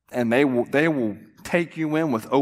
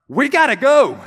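high, loud panicked urging; urgent shout; 11 semitones higher, 8 dB louder than usual. Retold or imitated. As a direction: hurry her out the door. Husband urging wife to hospital.

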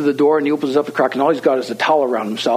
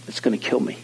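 soft, barely voiced resignation; grave hushed admission; 6 dB quieter than usual. Young man facing where heroin leads, hushed and grave. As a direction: imitate a quiet, sober realisation.

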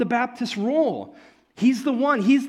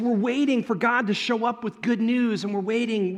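ordinary talk, nothing marked

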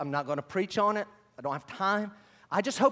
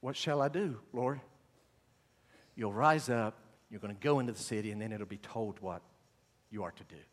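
softer, lower and fading to near silence; hushed dialogue; 9 semitones lower, 7 dB quieter than usual. Acted. Preacher voicing Saul's quiet question and the answer, hushed. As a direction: let the words fall away softly.

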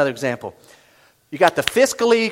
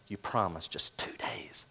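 slow hushed whisper; low breathy hush, slowed down; 9 semitones lower, 15 dB quieter, slower than usual. Real, not acted. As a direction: whisper it like a reproach. Preacher hushes to congregation.

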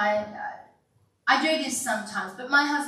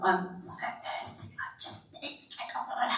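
parched whisper; 4 semitones lower, 8 dB quieter than usual. Acted. Parched voice fading out.

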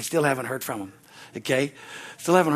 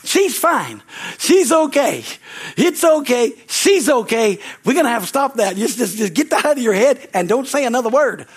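1 firm reassurance; 6 semitones higher, 4 dB louder than usual. Retold or imitated. Reassuring frantic parents.

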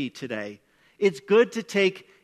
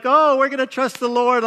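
bright put-on cheer, higher and louder; forced cheerful; 6 semitones higher, 7 dB louder than usual. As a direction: sugarcoat bad news with a brave happy voice. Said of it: "trying to sugarcoat this".

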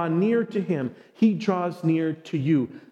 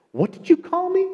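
much higher, louder startled demand; shocked question; 14 semitones higher, 4 dB louder than usual. Retold or imitated. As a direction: fire it back in disbelief. Girl reacts sharply, hurt.

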